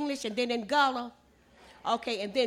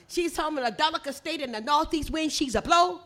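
higher, lilting Gullah storytelling; 4 semitones higher, 3 dB louder than usual. Shown as a demonstration. Storyteller resuming the fisherman's excuse, in Gullah. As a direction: tell it in Gullah, pitched up. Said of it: "Listen at it in Gullah".

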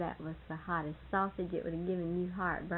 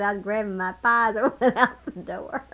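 laughing; 8 dB louder than usual. Retold or imitated. Laughing through Dal's quote.

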